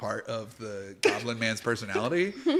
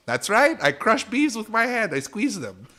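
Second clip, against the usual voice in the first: higher, boastful goblin brag; proud goblin; 8 semitones higher than usual. Acted. Goblin bragging to Honeycrisp about beeswax, lightly raised voice. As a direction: show off the mustache secret.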